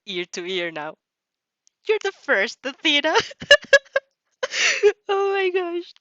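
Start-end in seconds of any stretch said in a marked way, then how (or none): 1.9-3.1 happy declaration. Acted. Izuku tells Athena she's first. 3.2-4.0 loud, high fangirl squeal; delighted squeal. Real, not acted. Reader squeals at Izuku's line. 5.1-5.9 overwhelmed gush. Real, not acted. Reader overwhelmed by Izuku's line.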